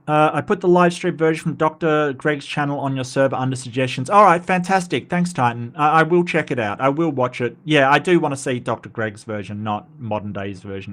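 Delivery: ordinary talk, nothing marked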